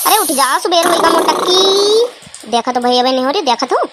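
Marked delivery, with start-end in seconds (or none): 0.1-2.5 stretched calling out, higher; sing-song wake-up nag; slow nagging call. Acted. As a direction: drag it out to wake her. Mother rousing sleeping daughter.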